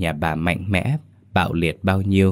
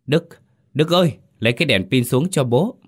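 higher, calling upstairs to his son; 7 semitones higher than usual. A narrator's reading. Father in the blackout calling his son upstairs for a flashlight. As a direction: call out to the boy upstairs in the dark. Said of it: "miệng cố hét lên".

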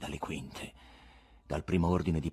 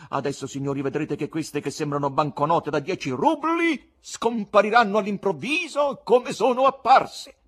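loud, high showman's address; sonorous announcement; 12 semitones higher, 10 dB louder than usual. A narrator's reading. Presenter to an excited audience, trying to master himself, sonorous proclamation. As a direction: project to the whole theatre like a compère. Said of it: "con la voce più sonora di cui disponesse".

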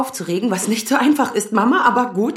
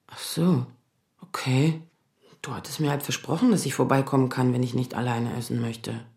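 hurt and quiet; 9 semitones lower, 7 dB quieter than usual. Acted. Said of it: "Jetzt sei nicht traurig".

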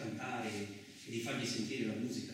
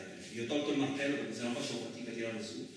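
normal talking voice

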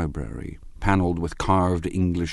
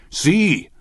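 high, loud cry of triumph; sudden high-pitched drunken exultation; triumphant cry; 13 semitones higher, 7 dB louder than usual. A narrator's reading. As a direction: crow it like a tipsy man proved right. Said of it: "he cried triumphantly".

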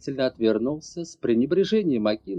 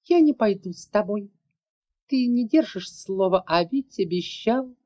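put-on high child's voice, reproachful; defiant little girl; 7 semitones higher than usual. A narrator's reading. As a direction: daughter accusing father of broken promise. Girl defies father.

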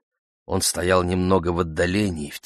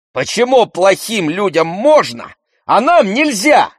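high, loud, heated protest; boiling over; 13 semitones higher, 7 dB louder than usual. A narrator's reading. Brother disputes father's answer, boiling over. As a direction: flare up, climbing higher and louder. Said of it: "вскипел Андрей".

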